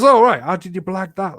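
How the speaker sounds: mocking neighbour. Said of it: "He goes".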